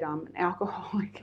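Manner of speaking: barely voiced, unsure first confession; timid whisper. Newcomer confessing at her first meeting, timid whisper. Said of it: "I raised my hand and I said".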